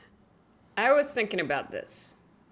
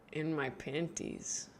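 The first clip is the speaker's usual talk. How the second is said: ordinary talk, nothing marked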